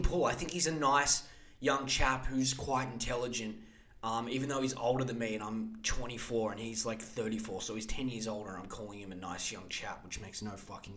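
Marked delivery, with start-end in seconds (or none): none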